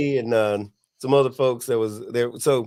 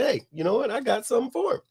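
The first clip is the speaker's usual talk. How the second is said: higher, pointed call-out; 10 semitones higher, faster than usual. Retold or imitated. Speaker calling out to the armed man, high call-out. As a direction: get his attention before you act. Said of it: "I say".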